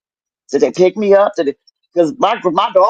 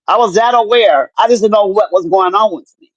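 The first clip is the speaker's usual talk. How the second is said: ordinary delivery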